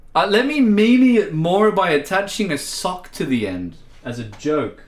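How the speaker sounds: usual speaking voice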